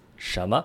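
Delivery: 什么 as one joined word, the first n dropped. Shown as a demonstration. Teacher showing the real pronunciation, 什么 joined without the n. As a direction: run 什么 together without sounding an n before the m. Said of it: "You just drop that N and bring it together".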